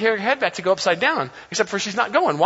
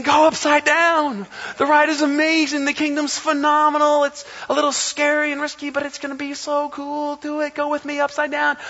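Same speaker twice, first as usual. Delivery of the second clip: higher, encouraging invitation; inviting Jesus voice; 9 semitones higher than usual. Retold or imitated. Jesus urging believers to trust him, lightly voiced encouragement. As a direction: coax them gently to take the leap. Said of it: "and Jesus is saying".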